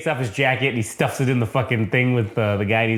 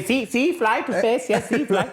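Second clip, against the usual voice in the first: mock janitor; 9 semitones higher than usual. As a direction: stretch the catchphrase out high. Janitor catchphrase again, retold.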